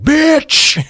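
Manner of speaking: hammed-up panther screaming an insult; panther yelling. Mock panther shrieking at the woman staring at it, loud and high. Panther voiced cursing at her.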